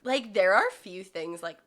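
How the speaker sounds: normal talking voice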